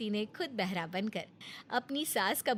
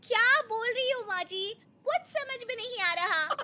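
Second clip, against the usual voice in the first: plain reading voice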